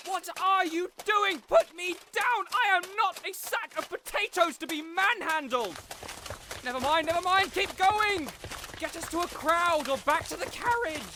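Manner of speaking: a panicked high-pitched noble in flight; flustered yelling. Acted. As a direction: bark orders while being carried. Carried off in a chase.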